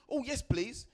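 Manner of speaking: high, eager reply. Retold or imitated. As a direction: jump at the offer.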